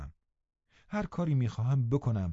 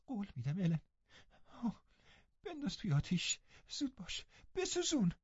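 high, soft, hurried begging; anxious young girl; urgent pleading girl; 11 semitones higher, 8 dB quieter than usual. Acted. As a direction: beg her to burn them now.

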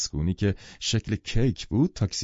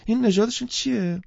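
much higher, keen asking; eager question; 13 semitones higher than usual. Acted. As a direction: ask it leaning in with eager curiosity. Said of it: "با اشتیاق پرسید". (پرسشی مشتاقانه با صدای بالاتر)

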